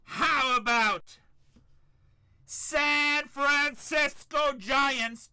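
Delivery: high, loud, drawn-out hype call; excited team call. Real, not acted. Card breaker hyping the Giants hit to chat, drawn-out excited call. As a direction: stretch it out like announcing a big hit.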